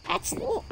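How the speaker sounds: high tiny laugh; squeaky cartoon giggle.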